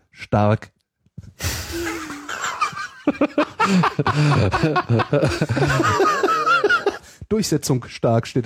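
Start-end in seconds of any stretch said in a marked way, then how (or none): 1.2-7.0 long laugh. Real, not acted. Laughing at the caption.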